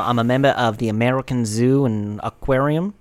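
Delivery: Australian wildlife presenter spoof; Jack Wallaby, Australian accent. Acted. Presenting his horse dog to the host, Australian accent. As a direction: play an Australian animal showman. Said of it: "pretty good fucking Australian accent".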